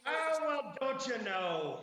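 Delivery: put-on high, loud lilting Irish accent. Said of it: "I'm not even gonna try to do the Irish accent".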